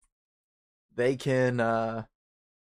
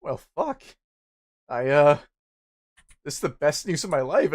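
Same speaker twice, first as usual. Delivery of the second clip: mock relieved guy; 2 semitones higher, 6 dB louder than usual. Guy hearing bad news about himself, mock crying relief. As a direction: crack up with relief, hammed up. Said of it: "but he was like".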